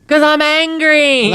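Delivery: very high, drawn-out mock holler; mock yelling. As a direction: sustain it high like a yell across the yards. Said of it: "kept yelling".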